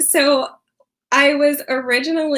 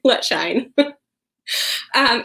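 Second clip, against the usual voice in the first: ordinary talk, nothing marked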